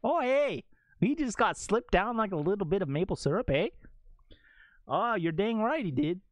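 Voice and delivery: chummy Canadian sportscaster impression; Canadian football commentator. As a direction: call it like a folksy Canadian announcer. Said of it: "Canadian commenters football would be amazing".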